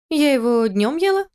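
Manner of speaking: put-on high, innocent tipsy girl; drunk girl voice. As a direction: answer like a drunk child. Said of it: "ответила она".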